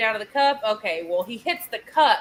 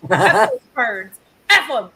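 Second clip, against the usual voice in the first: fed-up outburst; 8 dB louder than usual. Retold or imitated. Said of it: "And I'm like".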